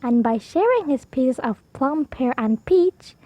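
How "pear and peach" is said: plain reading voice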